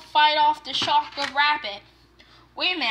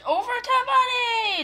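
hammed-up charge cry; pretend battle cry; 10 semitones higher, 5 dB louder than usual. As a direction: shout it as a play attack. Toy charging at the bunny.

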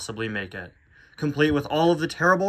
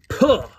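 short, high, loud puff; villain's loud dismissive puff; spat "puh"; 7 semitones higher, 6 dB louder than usual. Acted. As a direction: spit it out in one burst. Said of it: "said the new car".

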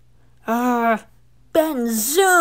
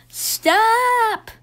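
sudden high shout; yelled; 11 semitones higher, 6 dB louder than usual. Acted. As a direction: yell it out in alarm, much higher and louder. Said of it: "yelled Thomas".

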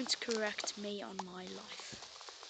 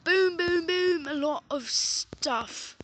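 loud booms; 5 semitones higher, 10 dB louder, slower than usual. Pretend. Loud boom sound effects.

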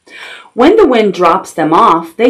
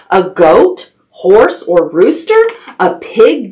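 ordinary delivery

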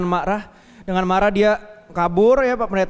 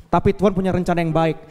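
ordinary delivery

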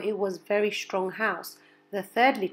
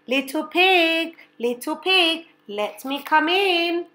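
high, slow wolf call; 8 semitones higher, 5 dB louder than usual. Acted. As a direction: coax like a sly wolf. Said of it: "The wolf knocked on the door and said".

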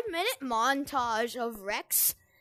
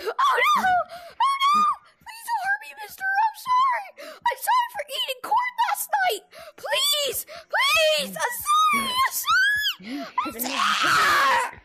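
shrieking, begging for mercy; high panicked pleading screams; terrified horror victim; 6 dB louder than usual. Pretend. Begging the corn monster for mercy.

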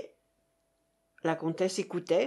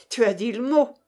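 louder, mild sneer; 6 dB louder than usual. A narrator's reading. Russian mocking his partner's reward, sneering. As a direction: snigger at the partner's choice of word. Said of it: "ricana l'autre".

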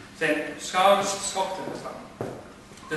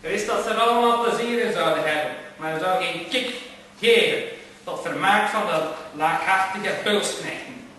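ordinary delivery, nothing marked

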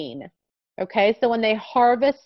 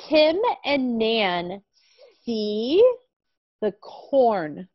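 very slow, clear reading; reading to a toddler; much slower than usual. Shown as a demonstration. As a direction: read word by word for a small child. Models the sentence slowly.

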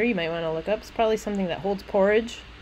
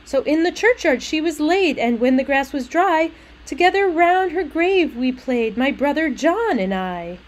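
higher lilting verse recital; little maid; 6 semitones higher, 4 dB louder than usual. Acted. Little maid telling of play by the grave, sing-song. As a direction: read it with a child's sing-song bounce. Reads the maid's lines.

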